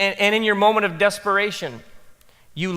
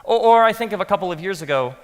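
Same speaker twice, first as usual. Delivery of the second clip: usual speaking voice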